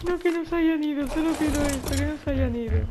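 high loud desperate plea; panicked high shout. Real, not acted. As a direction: beg loudly in panic.

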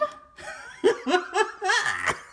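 loud, high-pitched cackling laugh; big high laugh. Real, not acted. Host cracking up at her catchphrase, big laugh. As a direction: crack up at your own line.

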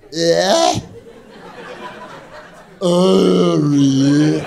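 friend's sneer replayed in slow motion. Shown as a demonstration. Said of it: "I'll do it in slow motion".

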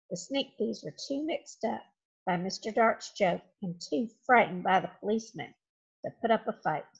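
usual speaking voice

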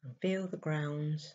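soft, slow meditation voice with long pauses; guided meditation. Acted. Guiding listeners to close their eyes and breathe, soft meditation voice. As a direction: ease them gently into stillness, leaving space to breathe.

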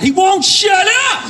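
high, loud, slow mock rant; imitated angry outburst. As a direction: shout it like the furious bar guy. Imitating the angry bar guy.

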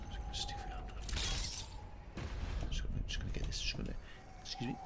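soft whispered muttering to self; a real hushed whisper. Real, not acted. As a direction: whisper as you focus on the game. Whispering while concentrating.

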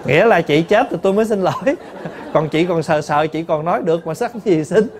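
chuckling through the words; laughing. Real, not acted. Nun joking with the congregation, laughing through it. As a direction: laugh as you deliver the punchline.

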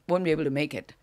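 ordinary talk, nothing marked

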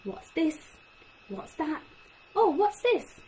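testing questions. Shown as a demonstration. Speech therapist showing parents testing a child, quizzing. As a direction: quiz a child like a tester. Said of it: "Testing is when you're asking".

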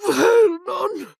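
very high call breaking into a cry; panicked, tearful wail; sobbing shriek. A narrator's reading. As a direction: wail his name near tears. Wife reads the letter, horrified.

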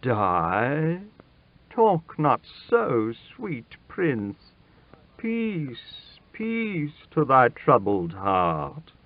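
put-on calm a terrified child, drawing words out; tender royal comfort; soothing king. A narrator's reading. King soothes the pleading boy.

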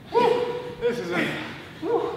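high whooping pump-up; excited whoops. Real, not acted. Hyping himself up mid-workout.

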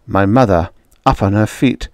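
usual speaking voice

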